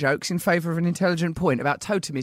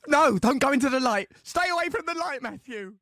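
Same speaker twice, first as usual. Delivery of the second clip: mock desperate plea; 7 semitones higher than usual. Friend begs dying Matthew away from the light, hammed up. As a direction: overact the plea, then let it sink.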